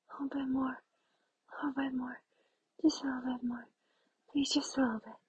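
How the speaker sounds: breathless pleading whisper. Acted. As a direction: beg in a hushed whisper. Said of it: "repeated pleading whisper".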